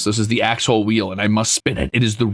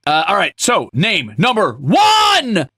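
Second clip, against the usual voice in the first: slow, loud game-show call; a showman's big drawn-out announcement; announcer call; 8 semitones higher, 4 dB louder, much slower than usual. Acted. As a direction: boom it out like a host. Announcing the wheel spin.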